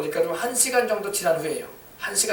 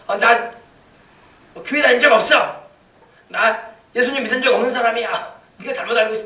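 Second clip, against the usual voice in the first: higher-pitched, protesting denial; indignant denial; 4 semitones higher, 4 dB louder than usual. Acted. A cornered believer denying church and Jesus, indignant. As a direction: deny it hastily, pitched up.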